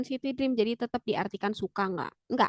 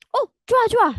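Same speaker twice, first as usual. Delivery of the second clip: loud, high-pitched "oh, great, great"; eager approval; 11 semitones higher, 7 dB louder than usual. Shown as a demonstration. Teacher acting out a pleased reply to show joa, eager. Acted example of saying okay, good.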